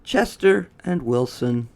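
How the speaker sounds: plain reading voice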